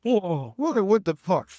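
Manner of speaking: high loud startled yell; shocked outburst. Real, not acted. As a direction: yelp in real shock. Sniffing smelling salts, shocked.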